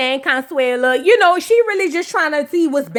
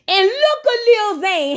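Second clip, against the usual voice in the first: higher and slower, drawn out; high mock-dramatic call; 8 semitones higher, slower than usual. Shown as a demonstration. Vlogger pointing at the squirming husband, high and slow. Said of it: "repeated catchphrase said high".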